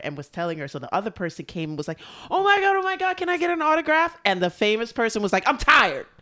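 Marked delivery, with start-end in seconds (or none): 2.3-4.2 much higher, louder shriek; excited squeal. Retold or imitated. Fan begs autograph. 5.5-6.1 curt and tired. Retold or imitated. Famous singer refuses.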